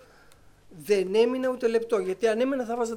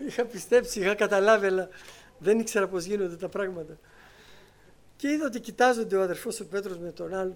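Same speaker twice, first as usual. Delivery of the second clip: ordinary talk, nothing marked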